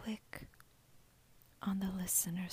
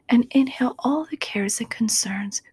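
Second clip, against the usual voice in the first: ordinary talk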